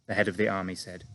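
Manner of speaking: ordinary delivery, nothing marked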